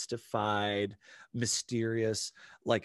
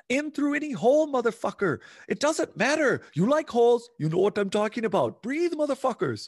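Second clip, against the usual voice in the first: much higher, louder manic guru voice; Wim Hof impression; 12 semitones higher, 8 dB louder than usual. Wim Hof yelling breathing advice at the crowd, high and loud. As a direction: bellow breathing orders like a wild coach. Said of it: "So this is Wim, by the way. I'm paraphrasing".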